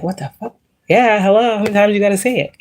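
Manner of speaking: high incredulous yell at the ringing ear; exasperated outburst. Retold or imitated. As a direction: burst out, fed up with the sign repeating. Viewer reacting to ear ringing.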